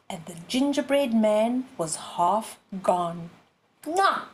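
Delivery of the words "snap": high sharp snap; fox snap. A narrator's reading. Fox bites again, a sharp high snap. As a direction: bite it off quick. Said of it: "went the fox again".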